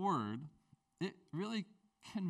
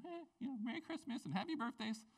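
much higher, softer sing-song "Oh, the joy of the Lord is your strength."; sweet card voice; 7 semitones higher, 5 dB quieter than usual. Retold or imitated. Pastor mimicking writing a card to kids, high soft sing-song. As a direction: coo it like a cheery greeting card.

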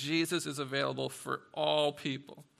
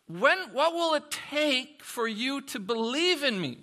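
exasperated plea; 9 semitones higher, 6 dB louder than usual. Retold or imitated. Preacher voicing frustrated Jesus.

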